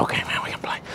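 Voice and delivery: whispered aside to the dog. Real, not acted. Trainer whispering to the dog during play, soft whisper. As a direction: breathe it out with no voice. Quietly to the dog mid-game.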